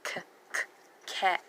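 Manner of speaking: "K, k" as bare consonant puffs, then "cat"; sounding out. Shown as a demonstration. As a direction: break off the first sound of "cat" before saying it whole. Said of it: "Short A".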